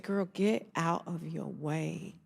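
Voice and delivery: put-on slowed, weighty girl get out of your way; slow, firm sisterly advice; slow, deliberate advice. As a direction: lay each word down slowly. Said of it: "She said".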